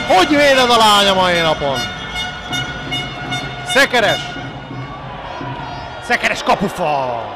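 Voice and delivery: thrilled commentator calling the post; excited hungarian sports commentary. Real, not acted. Save then post, commentary peak.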